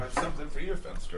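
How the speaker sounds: whispered aside, barely audible. Real, not acted. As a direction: hush it like a secret gift. Quietly handing over a gift.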